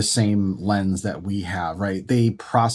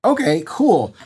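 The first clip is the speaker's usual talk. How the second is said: put-on kid relief; 7 semitones higher than usual. Son relieved that mom is only sick, high kid voice. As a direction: play the son suddenly relieved.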